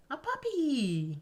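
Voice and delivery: high, slow delighted cry of a puppy; squealing with delight at a puppy; excited squeal. Acted. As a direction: gush over the puppy in a high voice. Reading the heroine's excited line.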